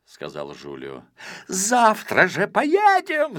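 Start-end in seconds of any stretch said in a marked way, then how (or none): 1.5-3.4 much higher, slow, jumping up with impatience; a hammed-up impatient yell; shouting eagerly. A narrator's reading. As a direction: cry it out, eager to go. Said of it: "закричал Спруц".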